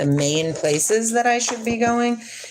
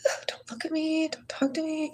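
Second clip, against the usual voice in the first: shy introvert; 10 semitones higher, 8 dB quieter than usual. Pretend. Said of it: "Or are you like".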